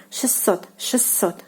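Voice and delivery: шестьсот said "shes-SOT", the T dropped, two S; шестьсот with no T, a double S; T omitted. Shown as a demonstration. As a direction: model шестьсот without the T. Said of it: "listen carefully".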